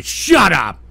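abrupt, irritated outburst; angry dismissal. Real, not acted. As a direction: cut it off with a bark. Host cuts off his mockery.

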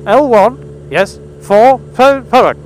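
patient but pointed repeat. Pretend. Repeating the postcode louder.

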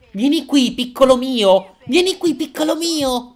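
high, drawn-out coaxing; eerie, sing-out maternal call; creepy mother calling. Acted. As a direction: lure your little one in a high voice. Voices the mother calling the child.

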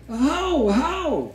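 very high-pitched hammed-up alarm; mock terrified cry. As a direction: wail it out high as a mother faking horror. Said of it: "и вдруг закричала".

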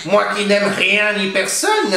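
a lonely witch sulking to herself; old witch muttering. A narrator's reading. Witch talking to herself, slow bitter crone voice. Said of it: "se dit-elle".

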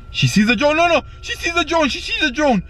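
high-pitched, playful alarm; excited panic. Pretend. Pilot warning that the pig wants to fight the drone, panicked. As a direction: cry out in mock panic.

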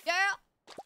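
put-on high, loud sassy "girl"; drawn-out sassy callout.

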